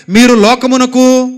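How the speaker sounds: slow, loud call for the crowd to repeat; loud declaration. Shown as a demonstration. Preacher leads the congregation in a loud, slow declaration. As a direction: proclaim it slowly and loudly. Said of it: "గట్టిగా చెప్పండి".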